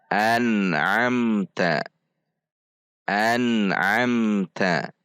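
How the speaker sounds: slow an'amta, clear N and M; practice recitation. Shown as a demonstration. Teacher models for practice, slow clear an'amta. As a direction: recite an'amta slowly, joined without bouncing. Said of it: "bacaan nun sukun dan mim sukun ini".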